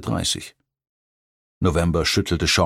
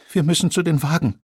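put-on much higher, whiny plea of a frightened girl; whining; 10 semitones higher, faster than usual. A narrator's reading. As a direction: whimper it in panic. November panics at the fire.

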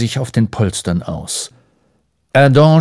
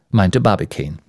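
ordinary delivery, nothing marked

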